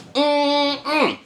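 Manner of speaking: high, short mock grunts; pimp's grunt. Imitating how a pimp stands there, high grunted "mm". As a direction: give two high-pitched, strutting "mm" noises.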